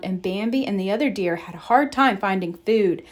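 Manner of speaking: usual speaking voice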